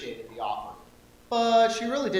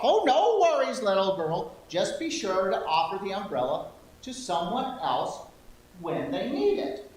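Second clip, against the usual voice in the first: put-on old lady voice; 3 semitones higher than usual. Shopkeeper reassuring the girl in old lady voice. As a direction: wave it off like a kind old lady. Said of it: "my old lady voice".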